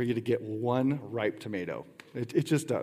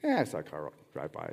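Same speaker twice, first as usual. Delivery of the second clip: offhand tone; 9 semitones lower, 5 dB quieter than usual. Shown as a demonstration. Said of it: "Kinda like".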